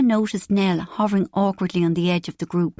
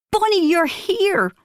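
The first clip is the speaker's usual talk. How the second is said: high, slow, delighted cry of welcome; excited greeting; 11 semitones higher, 3 dB louder, slower than usual. Acted. Mother greeting her son, delighted high cry. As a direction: gush over him like a doting mum.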